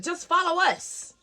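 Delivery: high, loud, slow cop command; called-out order. Retold or imitated. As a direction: call it out like an officer leading the way. Officer calls out an order.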